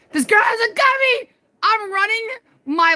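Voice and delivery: high, loud alarmed cry; panicked shout. Real, not acted. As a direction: scream it while fleeing.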